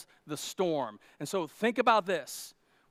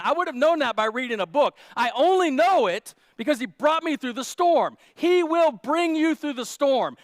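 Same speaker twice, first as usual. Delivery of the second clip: loud, driving preacher's build; full-force preaching; 5 semitones higher, 7 dB louder than usual. Real, not acted. Preacher urging the church to look for Jesus, loud and driving. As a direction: preach it home to the congregation.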